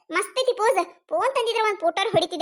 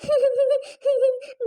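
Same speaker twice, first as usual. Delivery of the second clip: mock laugh; 4 semitones higher than usual. Wife mocking husband's choice.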